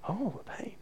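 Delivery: realising what she meant; surprised realisation. Retold or imitated. Preacher realising the pain is gone, soft surprised oh. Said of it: "I was a bit surprised".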